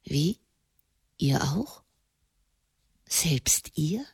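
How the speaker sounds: soft, low questioning; hushed astonishment. A narrator's reading. Marconi asks whether even Raymond fears her, hushed astonishment.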